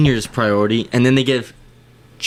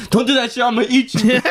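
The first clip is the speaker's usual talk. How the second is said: mock threat to dog; 9 semitones higher, faster than usual. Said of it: "You're gonna be like".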